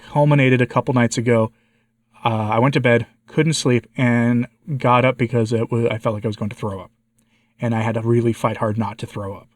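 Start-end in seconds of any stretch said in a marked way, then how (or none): none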